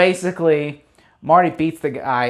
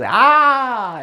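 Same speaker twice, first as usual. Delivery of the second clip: sudden high yelp of recognition; a quick, high startled cry; mock gasp of shock; 9 semitones higher, 4 dB louder than usual. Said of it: "the funny moment where he goes".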